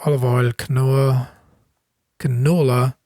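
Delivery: normal talking voice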